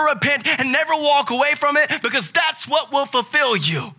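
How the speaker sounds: high, loud preacher building to a shout; impassioned sermon crescendo; full-force preaching. Real, not acted. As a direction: preach it hard, pitch climbing to a ringing peak.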